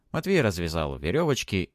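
plain reading voice

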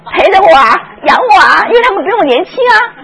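high, loud, crying plea; whining widow. Retold or imitated. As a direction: wail like a self-pitying old mother. Preacher voices a self-pitying widow.

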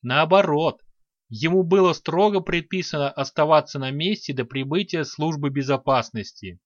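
ordinary delivery

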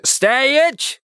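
sudden high bark of an order; barked command. A narrator's reading. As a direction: snap him to a halt. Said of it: "вдруг рявкнул сторож". (резкий окрик сторожа)

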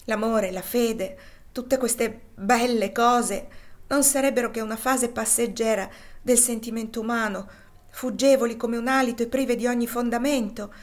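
despairing outburst. Acted. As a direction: let the denial swell into a cry.

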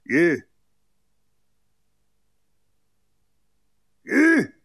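shouted donkey command; calling out to mule. Acted. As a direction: urge the donkey forward. Driving the mule on.

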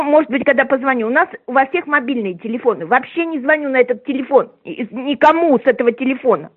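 ordinary talk, nothing marked

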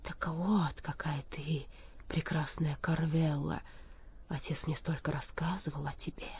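soft, hushed sorrowful murmur; quiet, wistful near-whisper; sad. A narrator's reading. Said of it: "печально произнёс".

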